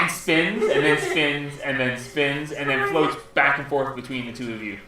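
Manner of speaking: ordinary delivery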